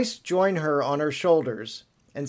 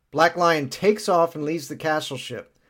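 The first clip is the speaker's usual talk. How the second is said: ordinary talk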